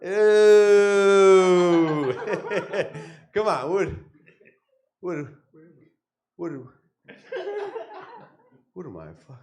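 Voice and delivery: hushed, dazed mobster on mushrooms, soft and wondering; a mob boss whose mushrooms just kicked in, mumbling in awe; tripping mafia boss. Acted. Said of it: "my impression of the moment this mafia boss".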